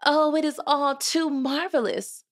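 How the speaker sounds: high, marveling exclamation; delighted. A narrator's reading. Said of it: "said Cinderella".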